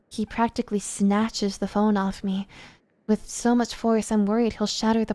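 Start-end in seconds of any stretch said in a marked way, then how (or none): none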